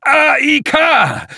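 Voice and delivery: very high, drawn-out shout; loud vehement call. A narrator's reading. Old man summoning his machine, shouting. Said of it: "rief er so laut".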